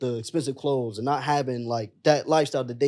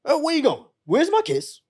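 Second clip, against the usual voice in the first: high, loving grandma voice, much higher; grandma calling him over; 10 semitones higher, 3 dB louder than usual. Retold or imitated. Grandson retells grandmother calling him to the kitchen table, high loving voice. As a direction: pitch way up like a doting grandmother.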